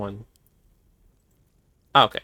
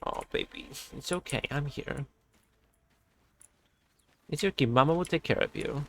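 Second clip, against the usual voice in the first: much softer, whispered soothing; soft whisper comfort; 11 dB quieter than usual. Real, not acted.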